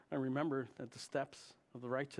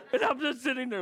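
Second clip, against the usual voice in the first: exasperated; 11 semitones higher, 10 dB louder than usual. Real, not acted. Comic build-up to punchline.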